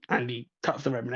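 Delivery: ordinary talk, nothing marked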